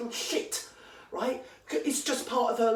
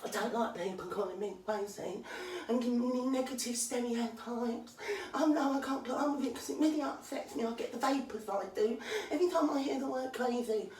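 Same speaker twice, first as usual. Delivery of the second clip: softer, self-pitying mock lament; mocking whiny imitation; 3 dB quieter than usual. Ranter mimicking the podcaster's fragile self-pity, softly. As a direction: parody her as fragile and precious. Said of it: "this is how deluded you are".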